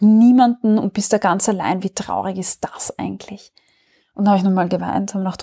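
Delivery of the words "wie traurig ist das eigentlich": whispered, breathy self-pity; a hushed, pitying whisper. Retold or imitated. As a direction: breathe it out like a sad secret.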